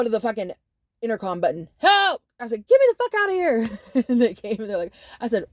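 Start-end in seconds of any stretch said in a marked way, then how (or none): 1.8-2.2 shout. Retold or imitated. Calling out on the intercom. 2.7-4.0 shout. Retold or imitated. Calling out on the intercom.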